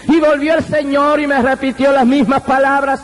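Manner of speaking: ordinary talk, nothing marked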